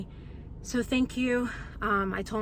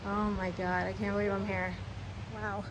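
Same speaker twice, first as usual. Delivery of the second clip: ordinary delivery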